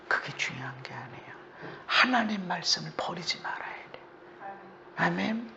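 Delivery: soft, breathy whisper; whispered exhortation. Acted. Preacher urging the congregation, quiet whisper. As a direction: press the point in a whisper.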